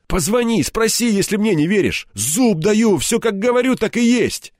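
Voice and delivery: high, emphatic urging; urgent insistence. A narrator's reading. Кирпич настаивает, клянётся. (настойчиво, горячо, высоким голосом)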